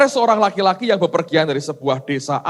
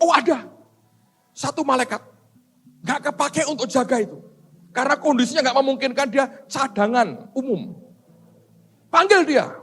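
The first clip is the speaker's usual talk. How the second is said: Gabriel explaining; 8 semitones higher than usual. Acted. Gabriel answers God.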